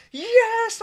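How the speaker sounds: loud, high-pitched imitated "yes"; goal celebration yell. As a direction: mimic a fan erupting at a goal. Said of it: "your celebration when that goal went in".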